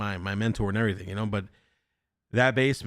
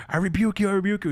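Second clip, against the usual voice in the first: pastor's rebuke; 8 semitones higher, faster than usual. Retold or imitated. Pastor grandfather rebuking the creature.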